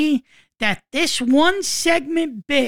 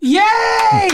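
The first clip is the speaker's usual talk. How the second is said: high loud cheering; excited cheer; 8 semitones higher, 3 dB louder than usual. Real, not acted. Cheering after song.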